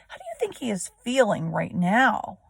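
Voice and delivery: normal talking voice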